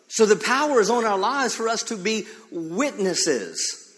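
full-force sermon delivery with drawn-out emphasis; fervent preaching. Real, not acted. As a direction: drive it home to the congregation, leaning on key words.

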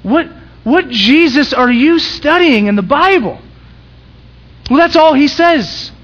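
high, loud, slow preaching; preaching at full force. Acted. Pastor challenges the congregation, high and loud preaching. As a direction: drive it home from the pulpit.

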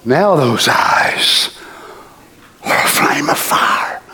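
slow, low awed whisper; hushed whisper. Real, not acted. Preacher describing Christ's fiery eyes to the congregation, hushed whisper. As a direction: breathe it in awe.

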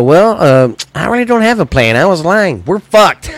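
high, fast mocking impression of the president confessing; hammed-up mock confession. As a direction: send up the president as a clueless liar. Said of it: "our, uh, president we have now says".

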